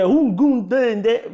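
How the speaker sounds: slow, drawled Jamaican country patois; imitated country patois. Joking with a friend about country talk, mock Jamaican patois. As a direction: mimic lazy rural Jamaican patois talk. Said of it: "The country is more lazy talk".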